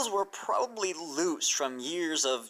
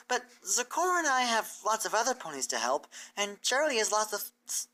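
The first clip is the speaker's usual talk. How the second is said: raised, earnest teacher pony explaining; bright scholarly mare, raised pitch; Twilight's voice; 8 semitones higher than usual. Acted. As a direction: lay out the plan to her hopeful and clear.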